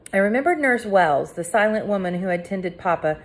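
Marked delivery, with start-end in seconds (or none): none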